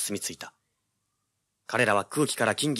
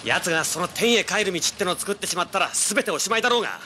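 much higher and rising, impatient; exasperated; 8 semitones higher than usual. Acted. Detective scolding his partner for missing the point, impatient and high.

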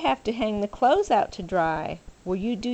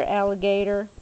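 ordinary delivery, nothing marked

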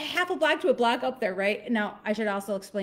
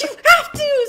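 high, loud retold shout; imitated frantic insistence; urgent insisting; 10 dB louder than usual. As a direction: push her on with a yell. Retelling urgent shout at mom.

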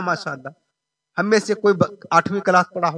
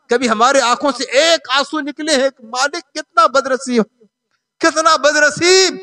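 impassioned lamenting preaching; 7 semitones higher, 4 dB louder than usual. Real, not acted. Preacher lamenting those who cannot read Quran, impassioned sermon. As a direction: lament it loudly to the congregation.

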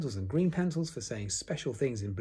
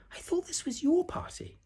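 very high, breathy piglet squeak; timid little Piglet. A narrator's reading. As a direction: whisper it high and tiny. Piglet's figure voice.

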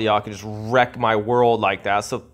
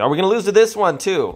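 exasperated outburst; 8 semitones higher, much faster than usual. Real, not acted. Gamer fearing another loss blurts it out high and fast. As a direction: rattle it off high in disbelief.

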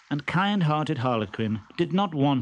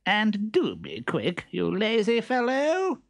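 high, bossy voice ordering his servant; impatient master; 10 semitones higher than usual. Acted. Stentorello scolding his servant, high and bossy. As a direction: hurry the lazy servant along. Character voice.

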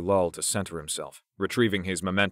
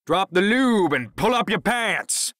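high, loud, drawn-out announcement; proclaiming; 13 semitones higher, 6 dB louder, slower than usual. A narrator's reading. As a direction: call it out from the next room. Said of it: "Anthony proclaimed from the kitchen".

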